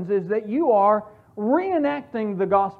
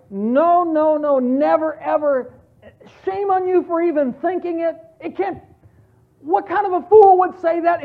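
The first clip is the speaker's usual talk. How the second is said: louder, higher outraged denial, "No, no, no, never, ever" hammered out; emphatic outraged no; 7 semitones higher, 6 dB louder than usual. Acted. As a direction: scold the very idea with disgust. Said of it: "such an emphatic case".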